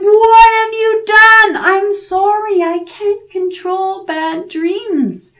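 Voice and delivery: high, loud, slow furious cry; storming mad yell. A narrator's reading. Devil storming mad after the third pluck. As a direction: drag out the rage.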